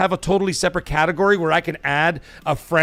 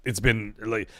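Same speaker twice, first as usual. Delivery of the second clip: softer, emotional voice close to tears; choked up; 6 semitones lower, 4 dB quieter than usual. Real, not acted. Streamer thanking viewers for support, choked up. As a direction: struggle to get the words out. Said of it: "I can't even speak anymore".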